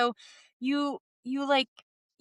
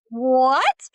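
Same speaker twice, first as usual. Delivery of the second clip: loud, bewildered what; stunned what; 3 semitones higher, 8 dB louder than usual. Retold or imitated. People hearing a channeled message, stunned and loud. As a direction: blurt it like your brain just broke. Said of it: "their brains break when they're like".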